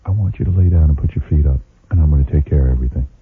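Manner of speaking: low, soothing husband voice; tender husband. Retold or imitated. Husband to wife, low soothing tender offer. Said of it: "I just turned to her and went".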